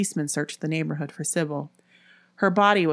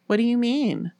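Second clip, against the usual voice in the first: higher-pitched guarded question; almost defensive; 7 semitones higher than usual. A narrator's reading. Ellen answering Nola's doubt, almost defensive. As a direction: push back slightly. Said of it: "returned almost defensively".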